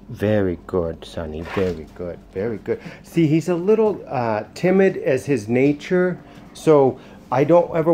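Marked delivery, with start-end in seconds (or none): 0.1-2.8 soft dog praise. Real, not acted. Praising the dog after the recall, low and soft. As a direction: lower and softer, gentle praise.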